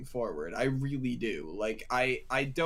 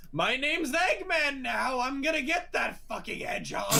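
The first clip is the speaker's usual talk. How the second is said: unhinged Eggman yell; 10 semitones higher than usual. Pretend. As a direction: scream it like a cartoon villain snapping. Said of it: "just goes".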